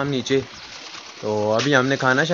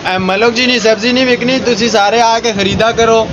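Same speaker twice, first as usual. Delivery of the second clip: vendor call; 8 semitones higher than usual. Pretend. Mock vendor call to customers.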